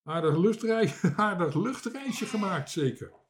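high, chuckling jibe; laughing tease. A narrator's reading. One sailor ribs his mate about flying, laughing.

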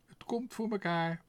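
high chirpy sparrow voice; eager sparrow. A narrator's reading. The sparrow promises Tos to return the letter, high chirpy voice. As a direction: promise it brightly as a little bird.

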